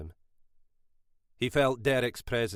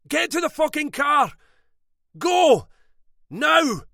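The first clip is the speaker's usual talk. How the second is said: much higher, much louder desperate shout; 10 dB louder than usual. A narrator's reading. Brother pinned to the wall yelling at his sister to run. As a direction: yell her out the door while pinned. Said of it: "Gavin shouted".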